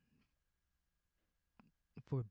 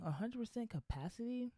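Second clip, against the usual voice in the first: high disbelieving echo of the figure; incredulous; 9 semitones higher, 4 dB quieter than usual. Real, not acted. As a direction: repeat it in disbelief. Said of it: "Like, what?".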